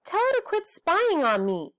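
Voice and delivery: high, complaining little-brother voice; whiny kid brother. Acted. Little brother complaining to Dad about his spying sister, whiny. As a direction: whine it up to Dad like a pesky kid.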